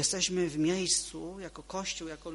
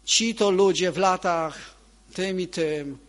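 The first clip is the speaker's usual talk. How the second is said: "Ci to ludzie" booming and slow, much louder and higher; mock pompous proclamation; 4 semitones higher, 12 dB louder, slower than usual. Pastor mocking a plaque honoring church elders, shouting grandly. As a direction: proclaim it like a grand, self-important decree. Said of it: "i powiemy".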